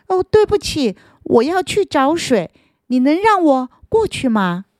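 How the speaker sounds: high, polite apology; apologetic root. Acted. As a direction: say sorry and ask to pass.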